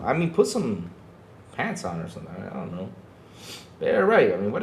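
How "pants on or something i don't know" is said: usual speaking voice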